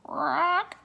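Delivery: high croaking raven voice. Acted. Raven answering Annie with a high croak. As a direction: croak the name like a bird. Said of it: "the raven croaked".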